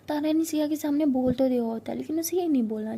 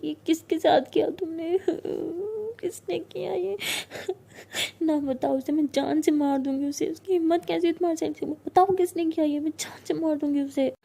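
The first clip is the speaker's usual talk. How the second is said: acted tearful rage, pitched up; distraught shouting; 4 semitones higher than usual. Siya demands who did it, crying and shouting. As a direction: threaten through sobs, voice raised. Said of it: "बहुत ज़्यादा shout कर रही होती है".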